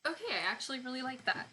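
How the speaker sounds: normal talking voice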